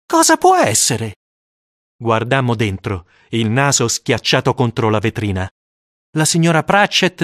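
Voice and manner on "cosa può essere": worried schoolboy. Acted. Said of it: "ci chiedevamo".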